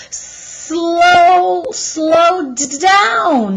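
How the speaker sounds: high, loud, jolted stammer; panicked plea. Acted. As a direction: beg her to slow down while bouncing. Jack jolted, begging to slow.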